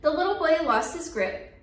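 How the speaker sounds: plain reading voice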